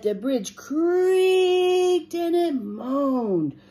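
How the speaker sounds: high, slow, stretched-out words; creaking, moaning narration. A narrator's reading. Bridge strains under the biggest goat, creaking drawn-out telling.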